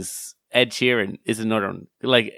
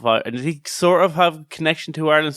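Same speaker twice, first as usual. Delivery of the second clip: ordinary talk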